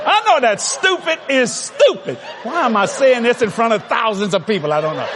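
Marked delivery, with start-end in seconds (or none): none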